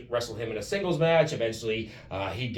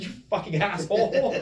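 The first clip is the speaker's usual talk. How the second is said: high mock outrage breaking into laughter; laughing curse; 9 semitones higher, 3 dB louder than usual. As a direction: curse him while cracking up.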